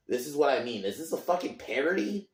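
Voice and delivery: high-pitched, exasperated disbelief; incredulous. Real, not acted. Fan ranting about a monster movie, incredulous. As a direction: ask it like you can't believe it.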